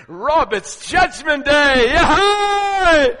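slow, loud, then a high whoop; joyful shout. Retold or imitated. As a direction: burst out in delight. Joyful Jesus greets Rob.

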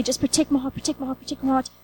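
hushed, inward plea for protection; soft prayer. Retold or imitated. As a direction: repeat it softly, pleading. Retold prayer in the bathroom.

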